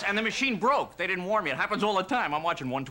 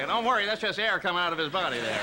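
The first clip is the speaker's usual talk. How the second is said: normal talking voice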